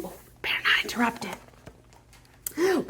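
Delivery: hushed unvoiced whisper. Storyteller hushes children past the snoring lion, whispering. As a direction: whisper so the sleeper won't wake.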